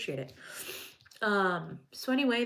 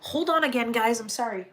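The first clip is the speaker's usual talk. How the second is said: a sudden higher, louder call to the viewers; 5 semitones higher, 8 dB louder than usual. Real, not acted. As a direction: snaps back to full voice to excuse herself. Breaks off for the dog.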